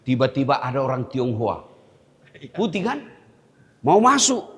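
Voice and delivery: ordinary talk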